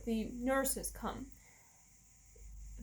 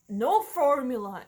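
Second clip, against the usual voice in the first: "no formula" slow, much louder, NO punched; firm refusal; 10 dB louder than usual. Retold or imitated. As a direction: lay down the rule hard. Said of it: "because I thought".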